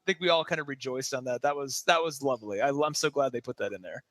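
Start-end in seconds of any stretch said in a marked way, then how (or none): none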